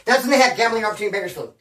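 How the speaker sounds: fast, Bakersfield sentence run together without pauses; rushed as one word. Shown as a demonstration. Retelling the casino line, words blurred fast together. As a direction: rattle off gambling opportunity in Bakersfield as one word. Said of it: "I made it sound like one word".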